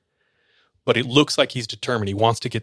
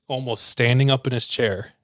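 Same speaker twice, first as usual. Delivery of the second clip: usual speaking voice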